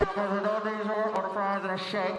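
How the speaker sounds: crackly intercom read-back; drive-thru speaker. Acted. Worker reads back the order, flat speaker voice. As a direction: repeat the order through a fast-food speaker. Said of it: "They'll come on the speaker".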